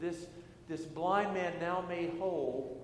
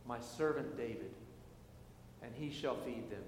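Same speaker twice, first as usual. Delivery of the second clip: scripture reading; 5 semitones lower, 5 dB quieter than usual. Acted. A pastor reading Ezekiel to the congregation, measured and solemn. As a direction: read the prophecy with weight and pauses. Reads scripture lesson aloud.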